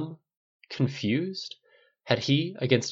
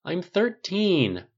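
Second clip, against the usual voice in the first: put-on high, drawn-out teenage protest; 8 semitones higher, much slower than usual. A narrator's reading. Thirteen-year-old son to his cuddling mother, protesting. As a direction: stretch it out, indignant at being babied. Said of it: "Tony protested, squirming".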